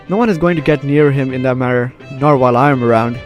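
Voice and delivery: ordinary talk, nothing marked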